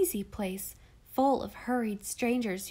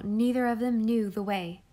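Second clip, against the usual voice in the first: plain reading voice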